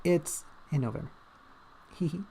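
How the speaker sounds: ordinary delivery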